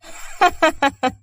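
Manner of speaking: high, gloating villain laugh; evil laugh. A narrator's reading. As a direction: laugh like a schemer who has won. Villains plot over the bride. (ضحكة شريرة شامتة)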